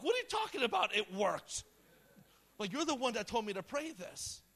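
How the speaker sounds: high, loud disbelieving retort; incredulous. Retold or imitated. Boy to youth pastor who told him to pray, incredulous. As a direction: throw it back at them, loud and high. Acted startled reply to shouting pastor.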